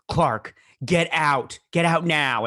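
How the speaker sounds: hammed-up commanding shout; mock urgent command. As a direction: act out an urgent warning, slow and forceful. Retold father urgently warns son.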